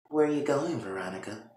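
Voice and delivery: hushed question, trailing off very softly; soft menace. Retold or imitated. Catherine to Veronica from the stairs, softly menacing. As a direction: quietly catch someone sneaking out.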